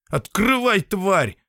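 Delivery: high, raging scream; furious yell. Acted. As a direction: scream at the machine. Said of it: "с яростью набросился".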